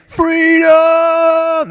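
high, very slow drawn-out cry; Braveheart battle cry. Retold or imitated. Youth pastor echoing the movie's catchphrase as a long high cry. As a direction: stretch freedom into a long high war cry. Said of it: "It is the catchphrase of the whole movie".

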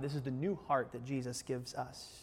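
ordinary delivery

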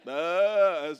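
sheep bleat. Retold or imitated. Preacher mimicking a silly sheep, bleating. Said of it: "A sheep just like".

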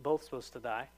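ordinary delivery

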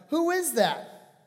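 high, drawn-out acted question; suspicious questioning. Dying father to disguised son, suspicious questioning. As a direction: slowly call out a puzzled challenge.

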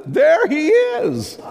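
high, slow, laughing reveal. A narrator's reading. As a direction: laugh through the punchline.

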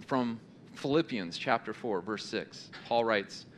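ordinary talk, nothing marked

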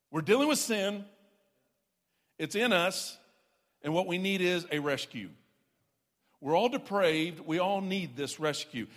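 loud, driving pulpit preaching; climbing sermon declaration; full-force preaching. Acted. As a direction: preach it hard to the congregation.

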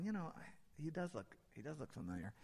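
hushed, hesitant aside; murmured suspicion. Acted. Bystanders round the fire whisper about Peter, murmured suspicion. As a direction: mutter it like a bystander half-recognizing someone.

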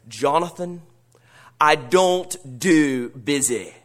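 put-on slow, deep Scottish accent. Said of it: "in his deep Scottish accent".

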